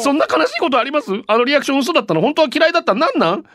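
高い声で畳みかける芝居がかった抗議; 冗談めかして悲しげに問い詰める; 大げさな嘆き. Acted. 便りの嘆きを芝居がかって読む. (高めの声で、わざとらしく嘆いて問いただす)

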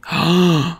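sudden audible gasp of alarm; sharp gasp. Real, not acted. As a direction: gasp at a worrying discovery. Fears she is blocked.

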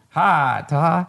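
put-on a light country accent greeting. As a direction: imitate the neighbor's country accent on "Hi, Ty". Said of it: "That's exactly how he sounded".

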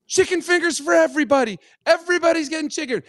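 high-pitched holler to the whole room; rowdy announcement. Retold or imitated. Storyteller imitating his friend ordering for everyone, high calling-out. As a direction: call it out like a big-hearted loudmouth.

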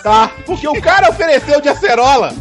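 ordinary delivery

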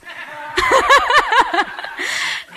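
bright burst of laughter, sliding down; high laugh. Real, not acted. Confessing to the host she didn't know the songs, high laugh. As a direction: laugh at herself, caught out. Admits not knowing the songs.

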